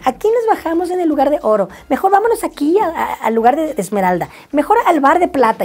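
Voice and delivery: high-pitched mock girl voice; voz de chica imitada. Imita a la chica del relato. (voz aguda imitando a la chica)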